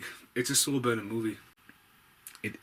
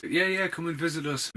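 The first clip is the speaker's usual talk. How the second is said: high, louder, put-on welcome; mock eager invitation; 7 semitones higher, 4 dB louder than usual. Voicing Earth welcoming aliens from space, mock eager. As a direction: call out a pretend invite to the aliens.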